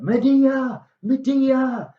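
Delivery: high, drawn-out call for someone. Acted. Jason arrives looking for Medea, high calling out. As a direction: call her name up high, as if across a yard.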